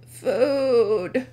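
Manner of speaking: put-on weak groan of a half-conscious goat-boy.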